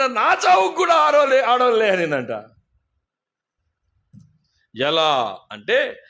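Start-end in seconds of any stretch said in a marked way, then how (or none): 0.0-1.9 higher, louder cockroach lament; hammed-up cockroach complaint; mock outcry. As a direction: wail it as the dead cockroach.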